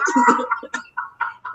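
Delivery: wheezing, airless laugh up high; breathy high laugh. Real, not acted. Cracking up after the quote.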